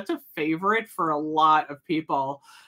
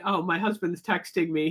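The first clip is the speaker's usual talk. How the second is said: ordinary delivery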